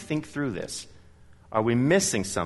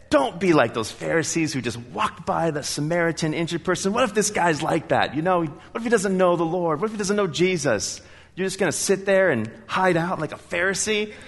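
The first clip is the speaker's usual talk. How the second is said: raised, pressing inner voice urging him; the nagging voice of conscience; 6 semitones higher than usual. Retold or imitated. Preacher voices his conscience urging him to witness.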